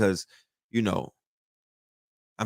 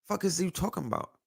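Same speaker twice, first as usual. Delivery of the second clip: scoffing; 5 semitones higher, 8 dB quieter, faster than usual. Acted. Imagined scoffing listener.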